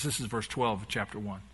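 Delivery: ordinary talk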